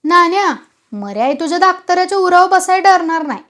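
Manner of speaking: acted menace; threatening. A narrator's reading. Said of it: "सटवाजीनं धमकी दिली".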